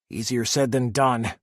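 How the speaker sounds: plain reading voice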